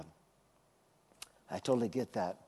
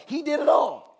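higher, louder proclamation; preaching climax; 11 semitones higher, 6 dB louder than usual. Acted. Preaching to the congregation, loud high proclamation. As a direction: lift it up and proclaim it. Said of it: "repeated preaching line".

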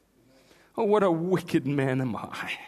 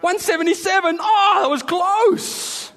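high, loud frantic yelp; panicked God; 13 semitones higher, 9 dB louder than usual. Pretend. Preacher mocks a flustered God, frantic loud shout. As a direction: shout it like a nervous fan watching a close call. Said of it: "He's not like".

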